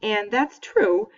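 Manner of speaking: ordinary delivery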